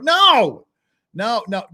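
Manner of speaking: loud, high, drawn-out no; mock outraged shout. Streamer sending a chatter to penalty box, mock outrage. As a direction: cut it off with a scolding yell. Cuts off chat message, scolding.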